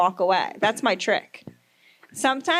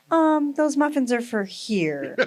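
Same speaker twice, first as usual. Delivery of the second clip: rich snobby lady; 3 semitones higher, slower than usual. Pretend. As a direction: talk down like a rich snob. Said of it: "sound like a rich, snobby lady".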